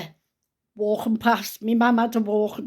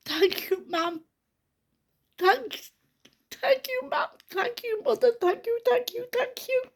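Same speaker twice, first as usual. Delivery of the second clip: much higher, soft voice cracking with tears; tearful thanks; 3 dB quieter than usual. Real, not acted. Grandmother thanking her late mother as the baby cries, tearful and high. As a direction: choke out the thanks through real tears.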